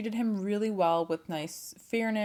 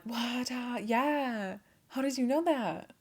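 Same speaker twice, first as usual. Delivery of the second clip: startled king; 6 semitones higher, slower than usual. Acted. King startled by Jay's question.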